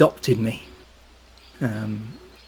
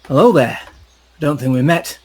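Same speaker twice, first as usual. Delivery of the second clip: louder, hearty hello; friendly greeting; 4 semitones higher, 6 dB louder than usual. Acted. Marazzo greeting Rothmere, hearty and louder. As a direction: greet the newcomer warmly.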